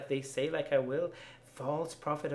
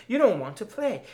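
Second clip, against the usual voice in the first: screamed; 6 semitones higher, 6 dB louder, faster than usual. Acted. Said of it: "I screamed".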